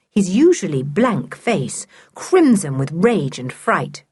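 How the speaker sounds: plain reading voice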